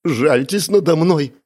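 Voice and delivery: high, slow pleading; tearful plea. A narrator's reading. Miss Spencer begging her interrogator after sobbing, pleading. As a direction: beg for pity, drawn out. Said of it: "пробормотала она".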